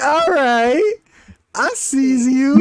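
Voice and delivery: high, slow, playful "I sees you"; high and drawn-out approving nod; impressed approval. Retold or imitated. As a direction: grant grudging respect, slowly. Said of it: "I was like".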